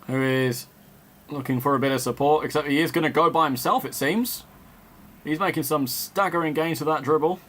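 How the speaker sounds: live play-by-play, caught up in the match; measured broadcast commentator voice; football commentary. Acted. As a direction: call the game like a TV commentator.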